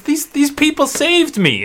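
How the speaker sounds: put-on high child's voice; a small girl pointing to her rescuers; little girl. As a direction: daughter telling her father who saved her. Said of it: "And she says".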